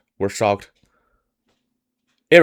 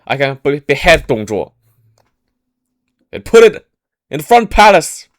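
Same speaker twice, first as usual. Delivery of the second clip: much higher and louder, rising to a proclamation; loud determined vow; 9 semitones higher, 8 dB louder than usual. Retold or imitated. Cao Cao vows to behead Dong Zhuo, loud and determined. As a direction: declare it like a fearless hero. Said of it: "loud voice".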